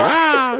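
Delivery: high-pitched startled yelp; mock scream. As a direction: imitate a panicked man's short scream. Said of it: "he starts screaming".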